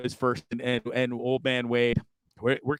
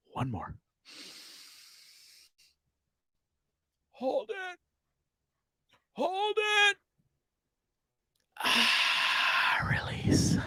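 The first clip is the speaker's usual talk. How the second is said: playful meditation coach; mock guided breathing; 3 semitones higher, 3 dB quieter than usual. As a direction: lead the audience through breaths. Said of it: "I'll do three deep breaths".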